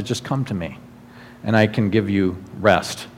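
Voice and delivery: low, hushed reassurance; tender invitation. Acted. Jesus to people cast aside or jailed, tender invitation. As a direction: drop soft and low, welcoming them gently.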